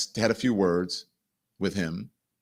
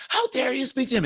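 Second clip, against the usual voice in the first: high-pitched screeching girlfriend imitation; mock screaming; 13 semitones higher than usual. Girlfriend screaming in his face, mock screech. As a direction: jump way up in pitch, mock outrage. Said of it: "screaming in my face".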